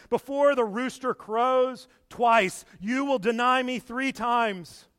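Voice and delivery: a loud, slow, solemn warning; solemn Jesus quote. Acted. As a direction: prophetic voice of Jesus, drawn out. Jesus' words recalled by Peter.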